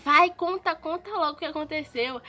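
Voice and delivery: imitating a worked-up dad, loud and high; retold agitated order, hit the first words hard; agitated demand. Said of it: "meu pai tava muito alterado".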